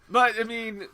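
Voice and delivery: normal talking voice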